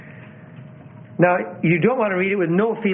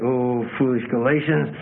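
hammed-up scornful scripture reading; wrong spirit; 2 semitones higher than usual. Shown as a demonstration. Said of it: "if you had the wrong spirit".

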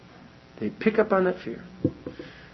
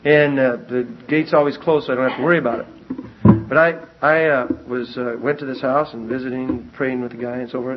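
ordinary delivery

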